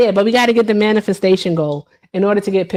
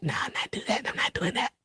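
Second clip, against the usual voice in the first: much softer, whispered no; whispered refusal; 14 dB quieter than usual. Real, not acted. Streamer whispering her refusal of a wish list. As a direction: whisper it to yourself.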